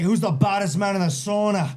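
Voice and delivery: put-on high, cocky Irish accent; Conor McGregor. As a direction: taunt in a put-on Irish brogue. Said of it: "he was like".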